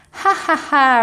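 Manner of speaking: high, loud ha ha ha; big booming laugh while tickled; roaring laughter. A narrator's reading. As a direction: roar with laughter like a tickled dragon. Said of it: "roared the dragon".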